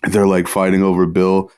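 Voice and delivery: ordinary talk, nothing marked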